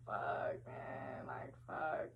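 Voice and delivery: hushed, whispered cursing; panicked whisper. Retold or imitated. Hiding from her boyfriend, whispering curses to herself. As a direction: curse under your breath, barely voiced.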